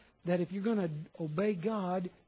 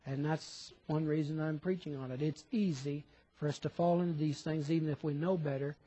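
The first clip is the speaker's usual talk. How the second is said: ordinary talk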